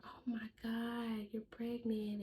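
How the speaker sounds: amazed friend. Retold or imitated. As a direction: imitate a friend's stunned reaction, stretched out. Voicing friend's reaction to test.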